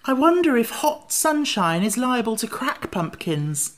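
high, mildly worried character voice; anxious ring. A narrator's reading. Jack fretting about sunshine, high and mildly anxious. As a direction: wonder aloud with a touch of worry. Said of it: "with an anxious ring".

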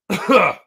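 sharp real cough; hacking cough, voice going; coughing fit. Real, not acted. Vlogger losing his voice mid-video, coughing. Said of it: "I'm losing my voice".

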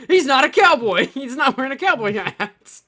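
high, loud mob outcry; hammed-up alarmed townsperson; mock accusation. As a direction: shout it out to rouse the town. Plays townsfolk exposing an imposter.

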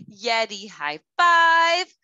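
slow, drawn-out cheer; hammed-up call. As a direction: stretch it out for the kids.

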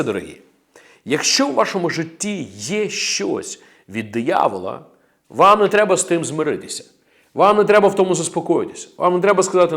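normal talking voice

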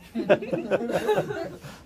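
a bright burst of laughter, rising; high delighted laugh. Real, not acted. Magician laughing at the spectator's joke, high delighted laugh.

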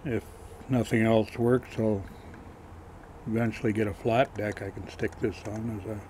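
normal talking voice

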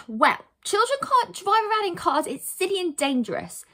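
high, emphatic lecture; prim scolding. Acted. Heroine lectures boys about driving cars, prim scolding. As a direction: tell them off with firm disapproval.

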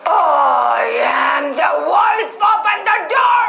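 the wolf announcing himself, then yelling; wolf shout. Acted. Wolf demands again.

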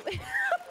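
squeaky burst of laughter; a sudden high giggle. Real, not acted. Laughing at braless sandwich.

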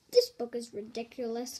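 ordinary delivery

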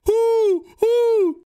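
high, long owl hoot. Pretend. Prankster hoots at his confused friend, high owl hooting. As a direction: hoot twice, hollow and high. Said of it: "Let's do it again".

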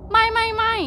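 high, loud panicked denial; panicked shout. Acted. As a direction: cry it out in alarm. Wife denying in panic.

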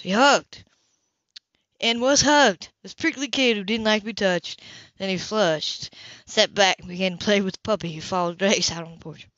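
usual speaking voice

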